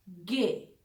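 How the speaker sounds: गे with a hard g; German G. Shown as a demonstration. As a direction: say गे, not जी. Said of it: "जी को यहां थोड़ा सा change करके बोलते हैं". (German में गे)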